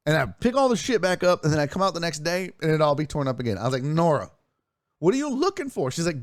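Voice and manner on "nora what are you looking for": much higher, exasperated; husband quizzing his wife about the mess, exasperated; accusing question. Retold or imitated. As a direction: ham up his past suspicion. Acted quarrel with Nora.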